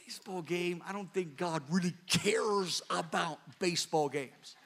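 soft, amused incredulity; laughing disbelief. Retold or imitated. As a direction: scoff gently with a laugh. Retold disbelief at Bob.

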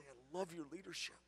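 very soft, gentle I love your leadership; hushed loving prayer; soft adoring prayer. Shown as a demonstration. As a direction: say it almost under your breath. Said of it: "I'm gazing at that light".

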